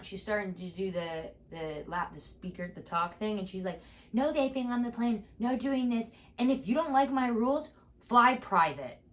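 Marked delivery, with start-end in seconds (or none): 4.1-9.0 pitched-up, sassy cabin announcement; mock attendant voice, higher than usual; drunk flight attendant. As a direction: lay down the rules over the intercom. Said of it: "the flight attendant was drunk".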